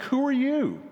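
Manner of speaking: high, startled demand from the wife; startled who are you. Retold or imitated. Wife finding a stranger in her kitchen, startled high demand. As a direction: catch an intruder at your table.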